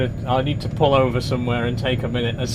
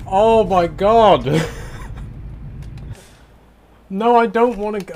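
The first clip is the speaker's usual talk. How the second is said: higher and louder, despairing disbelief; exasperated outburst; 5 semitones higher, 5 dB louder than usual. Real, not acted. Driver stuck at the terminal again, loud exasperated disbelief. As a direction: let the frustration burst out, pleading at the end.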